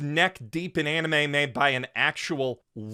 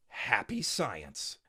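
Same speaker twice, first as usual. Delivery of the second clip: slow, much softer and lower; deadpan; 7 semitones lower, 10 dB quieter, much slower than usual. Acted. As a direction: let Happy Science drop flatly. Deadpan follow-up of name.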